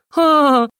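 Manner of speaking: high, loud ha-ha-ha; burst of laughter. Acted. Narrator laughing at deer chewing the drum, loud high laugh. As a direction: laugh out at the absurd sight.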